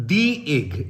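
"the" said as "dee" before egg, slow and clear. Shown as a demonstration. Teacher models "the" as "dee" for the vowel in egg. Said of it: "কারণ আমরা যখন বলছি the".

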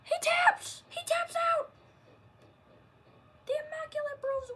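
loud, high victory call; wrestling commentator. Acted. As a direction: announce the winners to the arena. Commentator calls the win.

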